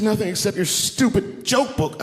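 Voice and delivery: high, slow, scornful voice; wife's exasperated high-pitched complaint; imitated angry wife. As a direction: spell it out in mock fury. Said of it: "she was mad at me".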